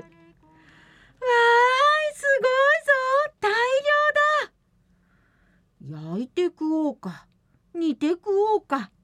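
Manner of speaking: high, bursting with delight; overjoyed. Acted. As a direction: cheer over a big catch. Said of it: "松は大喜び。".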